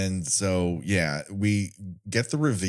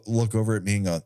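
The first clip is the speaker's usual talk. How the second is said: normal talking voice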